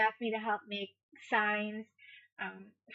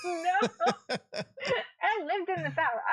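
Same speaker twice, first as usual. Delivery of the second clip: laughing; 9 semitones higher, 4 dB louder, much slower than usual. Real, not acted.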